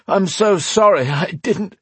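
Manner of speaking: high, urgent apology, cut off; emotional apology. Acted. Tearful reunion, apology cut off.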